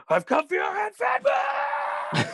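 high, drawn-out hammed-up shout; Mel Gibson. Fan quoting Fat Man's big threat, hammed-up shout. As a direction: roar it like a cowboy defending his land. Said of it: "that was a good Mel Gibson as Fat Man".

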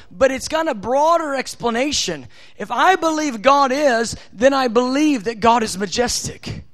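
preaching at force. Real, not acted.

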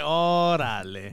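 slow, low, drawn-out órale; deadpan órale. Retold or imitated. His reply to the outfit, slow and resigned. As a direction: let it sink in, resigned.

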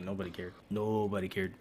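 soft, low, unimpressed reply; quietly deadpan dismissal; dismissive. Retold or imitated. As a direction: drop the voice and brush it off. Dismissive answer to NFT hype.

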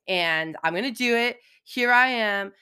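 usual speaking voice

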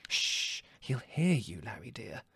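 soft, high motherly shushing; hushing. A narrator's reading. Mother hushing Larry so the guest won't hear, hushed.

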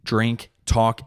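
ordinary talk

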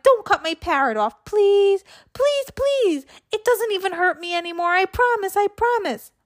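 put-on high, pleading little girl; begging child.

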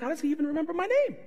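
amazed question. Retold or imitated. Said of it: "I'm like".

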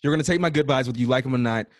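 bully them with good wishes, playfully; aggressive good vibes. Pretend. [name] forcing good vibes on a friend, playfully aggressive cheer. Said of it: "That is happiness with aggression mixed together".

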